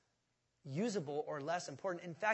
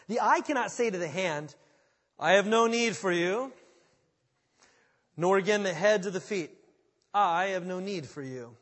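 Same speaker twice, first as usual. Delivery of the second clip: loud, ringing Bible reading; preacher's reading voice, louder; forceful scripture reading; 11 dB louder than usual. Acted. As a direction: proclaim the verse to the room.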